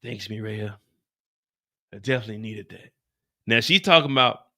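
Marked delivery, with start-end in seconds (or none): 0.0-2.9 soft, lower tired thanks; barely there, grateful; weak, worn out. Retold or imitated. Miguel thanking his sister after the shot, soft and spent.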